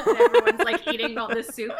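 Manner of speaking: high, loud words pushed out through laughter; cracking up mid-sentence; laughing hard at the memory. Real, not acted. Laughing while retelling soup scene.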